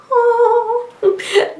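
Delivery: very high, loud giggling squeal; delighted high laugh to the viewers. Real, not acted. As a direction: burst into a high-pitched giggle. High laugh after sign-off.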